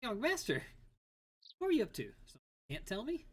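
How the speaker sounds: put-on high, loud nosy old servant; pry at the young master, squeaky; high teasing servant. Voicing servant Gremio teasing.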